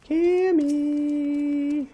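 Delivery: eerie high call, drawn out; creepy drawn-out call. Pretend. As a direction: call her name like a ghost. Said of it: "that creepy, Cammy?".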